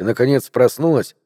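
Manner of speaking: plain reading voice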